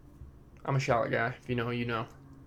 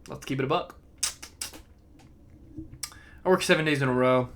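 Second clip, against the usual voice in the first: usual speaking voice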